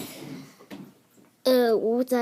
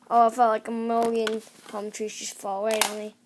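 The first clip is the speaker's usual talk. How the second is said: usual speaking voice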